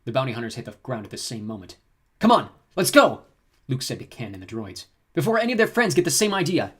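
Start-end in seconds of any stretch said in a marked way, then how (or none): 2.2-3.2 loud, high hurry-up call; urgent shout. Acted. Luke urging escape. 5.1-6.7 higher, louder hurried urging; urgent hero voice. Acted. Luke urging escape.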